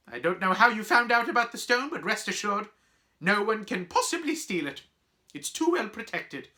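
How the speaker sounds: high, emphatic schoolmistress voice; startled stern professor. Acted. Professor reassuring students the stone is safe, stern.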